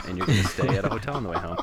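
laughing. Real, not acted. Laughing through the joke.

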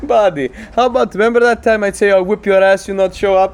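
high, loud taunting voice; mock the shopkeeper bully; mocking tough guy voice.

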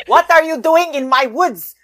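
loud, high, hammed-up pseudo-Austrian elf accent. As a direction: demand it indignantly in a weird cartoon Austrian accent. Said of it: "weird pseudo-Austrian accent".

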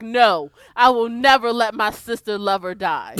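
loud mock-dramatic declaration; booming fake-noble vow; mock heroic vow. Luther vowing to save his sister lover, mock loud. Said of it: "Luther was just like".

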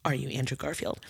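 low, soft flirtatious question; softly dramatized silent question; eyes speaking. Retold or imitated. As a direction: ask it low and hushed, like a secret look. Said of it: "So my eyes said".

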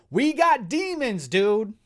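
high, loud whoop; real hyped excitement. Real, not acted. Host to co-host on the demon reveal, thrilled shout. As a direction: burst out with fanboy glee.

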